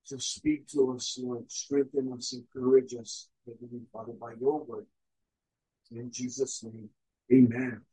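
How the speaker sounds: low, soft, earnest prayer. Real, not acted. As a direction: pray quietly to God, pitched low. Leads opening prayer.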